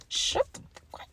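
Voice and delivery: unvoiced, urgent under the breath; whispered warning to a messy friend; hissed aside. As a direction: hiss it so nobody else hears.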